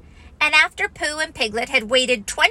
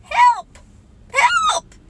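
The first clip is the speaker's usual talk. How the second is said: much higher, louder squeaky cries for help; frightened cry; 7 dB louder than usual. A narrator's reading. Piglet lost in the mist, crying help high. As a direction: squeal in panic like a tiny pig. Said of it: "the only thing he could think of".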